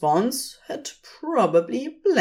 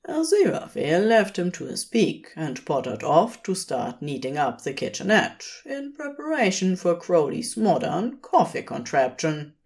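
plain reading voice

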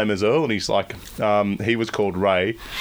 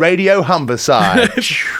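radio DJ voice; 6 semitones higher, 4 dB louder, much slower than usual. Shown as a demonstration. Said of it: "local radio jingle voice".

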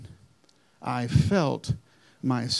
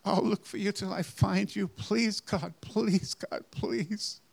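fading plea; 5 semitones higher than usual. Retold or imitated. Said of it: "my first prayer".

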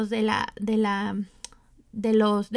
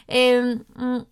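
mid-sip, mouth on the cup; sipping tea; 3 dB louder than usual. Real, not acted. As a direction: hum while swallowing tea. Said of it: "perdón, estoy tomando té".

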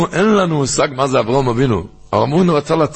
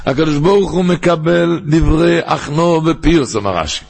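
high, measured study-hall singsong; singsong text reading; 5 semitones higher than usual. Shown as a demonstration. Rabbi reading Rashi's commentary to his listeners, raised singsong recitation. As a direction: intone the holy text phrase by phrase. Reads the commentary aloud in learning tune.

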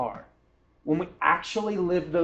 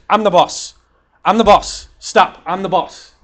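loud, higher-pitched mock outburst; insistent boss; 5 semitones higher, 11 dB louder than usual. Retelling Ari looking over at the girl talking, insistent and loud. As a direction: imitate someone pulling rank, loud and emphatic. Retelling a co-owner pulling rank.

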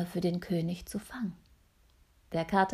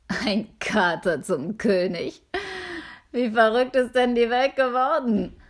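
incredulous guard, rising louder; suspicious guard; 4 semitones higher, 5 dB louder than usual. Acted. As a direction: scoff at a cat at the gate. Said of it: "wunderte sich der Wächter".

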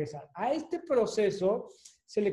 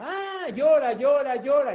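put-on llora, llora, llora, louder and higher, building like a wailing baby; llanto insistente; 5 semitones higher, 5 dB louder than usual. Shown as a demonstration. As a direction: pile up each llora as the baby keeps crying. Imita el llanto del bebé. ("llora, llora", más fuerte y más agudo, como bebé que no para)

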